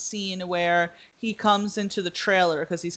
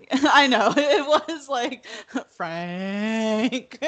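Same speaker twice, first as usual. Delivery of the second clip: real laughter through the words; laughing; 6 semitones higher, much slower than usual. Real, not acted. Host laughing at Frank shooting the man, real laughter. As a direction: laugh in disbelief at him.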